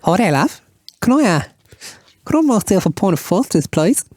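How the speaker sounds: a flamboyant Aussie ordering a pint; camp Australian. Acted. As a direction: camp Australian accent, sweetly asking.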